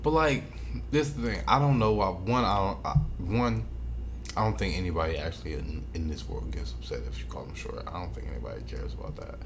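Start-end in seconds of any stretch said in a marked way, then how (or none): none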